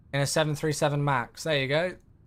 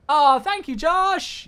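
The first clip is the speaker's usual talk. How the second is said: high, loud delighted thank-you; excited thanks; 10 semitones higher, 7 dB louder, slower than usual. Real, not acted. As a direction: sing out the name with a grin. Thanking a viewer on stream.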